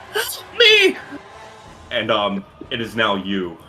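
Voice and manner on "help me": high, pleading scream for help; wounded brother crying out; desperate cry for help. Acted.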